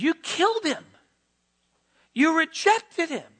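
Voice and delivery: high, forceful preacher's charge; pointed, unsparing indictment; blunt accusation. Acted. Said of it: "he doesn't mince his words".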